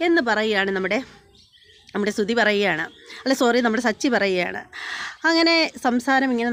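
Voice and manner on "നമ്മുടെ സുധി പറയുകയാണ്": ordinary talk, nothing marked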